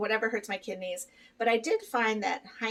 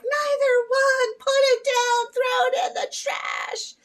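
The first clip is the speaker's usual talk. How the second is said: much higher, louder, drawn-out cry of protest; mock outraged shout; 7 dB louder, slower than usual. Answering the training video about John's lunch, with a high mock shout. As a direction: yell it like the sandwich is poison. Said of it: "And I'm like".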